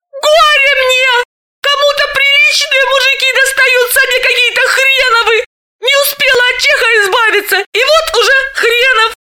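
high, hammed-up lament; mock despair. A narrator's reading. Galina jokingly clutches her head at her bad luck, mock despair. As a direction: wail in jokey despair. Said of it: "Галина шутливо схватилась за голову".

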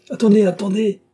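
high, loud call to halt; crying out. A narrator's reading. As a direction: call out urgently to stop her. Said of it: "cria-t-il".

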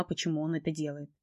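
ordinary delivery

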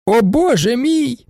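high, slow dismayed cry. A narrator's reading. Baroness cries out again before the judge. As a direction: wail it out, swaying. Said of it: "знову крикнула баронеса".

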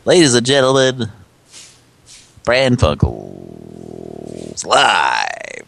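announcer voice. Acted. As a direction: stretch the show name like an emcee. Show intro.

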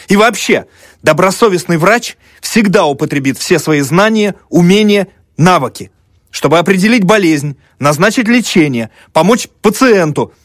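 high, loud, carried-away student; student on a tirade. A narrator's reading. Student defending medicine to the women, carried away and indignant. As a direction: pour it out in a high, insistent voice.

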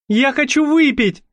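high capricious whine; whiny. A narrator's reading. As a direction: demand it like a spoiled girl. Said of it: "закапризничала Каталина".

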